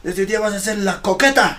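high military proclamation, imitated; yelling superior. Superior renaming the recruit on the spot, high imitated drill shout. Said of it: "me grita, pues".

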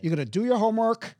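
loud, high, fast parental order; angry parent. Acted. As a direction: lay down the law to a child, loudly. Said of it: "they think they have to be angry".

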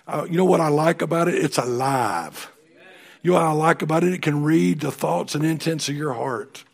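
revival-style exhortation; preaching. Acted. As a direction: press the point home to the room. Preaching at full force.

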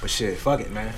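ordinary talk

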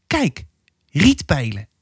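lightly voiced little girl, bright and clear; clear child's voice. Little girl showing her mother the painting, clear and wondering. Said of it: "zei helder".

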